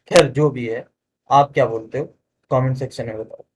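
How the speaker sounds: ordinary delivery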